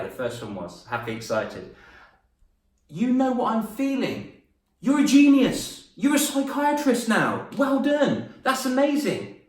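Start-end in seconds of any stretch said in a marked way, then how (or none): 2.9-9.4 high, loud, gushing praise for the class; delighted praise. Real, not acted. Teacher gushing to the class with high excited praise.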